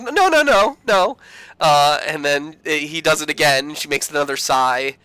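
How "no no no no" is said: much higher, louder sing-song denial; intern's mock-innocent high no. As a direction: protest too sweetly, up high. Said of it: "she's like".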